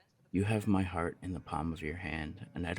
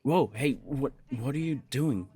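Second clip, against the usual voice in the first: higher and louder, caught off guard; startled outburst; 6 semitones higher, 5 dB louder than usual. Acted. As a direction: yelp in surprise as you're grabbed. Suddenly pinned down.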